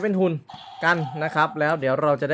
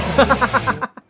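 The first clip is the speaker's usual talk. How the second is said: short laugh; 7 semitones higher than usual. Real, not acted. Reviewer laughs at toy sounds.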